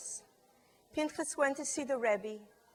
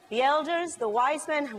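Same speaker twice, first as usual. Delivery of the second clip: usual speaking voice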